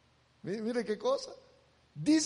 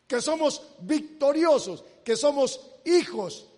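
ordinary talk, nothing marked

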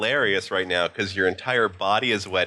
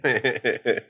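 normal talking voice

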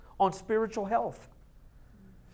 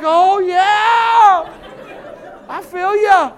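mock craving; 12 semitones higher, 18 dB louder, much slower than usual.

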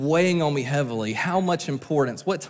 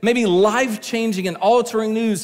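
higher, louder sermon climax; impassioned preaching; 6 semitones higher, 4 dB louder than usual. Real, not acted. Preacher encouraging church facing horrible news, impassioned preaching. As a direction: drive it home from the pulpit.

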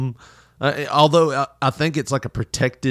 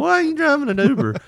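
much higher, put-on nosy voice; mock nosy passenger; faster than usual. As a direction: ask it like a tactless tourist. Mock blunt question to the driver.

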